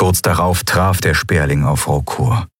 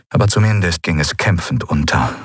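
ordinary delivery, nothing marked

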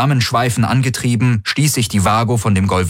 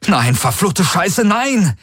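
high-pitched, frantic swearing; desperate cursing cry; 11 semitones higher, slower than usual. A narrator's reading. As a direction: curse in despair, voice climbing at the end. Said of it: "rief er". (verzweifeltes, hohes Fluchen)

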